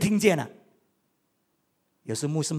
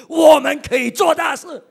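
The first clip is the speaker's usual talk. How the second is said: shouted out high and loud; preaching shout; 8 semitones higher, 12 dB louder than usual. Real, not acted. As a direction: proclaim it to the whole church. Preacher proclaims God does great things. (高声呼喊宣告)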